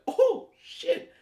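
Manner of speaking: very high, loud squeal of shock; shocked reaction. Retold or imitated. Viewer reacting to the neighbor being thrown out, high shocked squeal. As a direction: yelp it like you just saw something wild. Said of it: "I was like".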